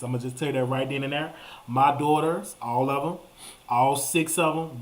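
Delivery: usual speaking voice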